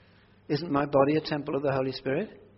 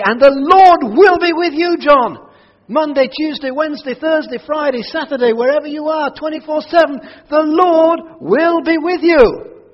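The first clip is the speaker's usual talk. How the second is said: exuberant reply; 12 semitones higher, 13 dB louder than usual. Shown as a demonstration. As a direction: shout it back with full confidence. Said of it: "And you would reply to me".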